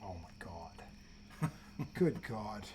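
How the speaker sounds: hushed and appalled; whispered disbelief. Real, not acted. Hearing a pundit knock Iverson, in an appalled whisper. Reacting to a bad take.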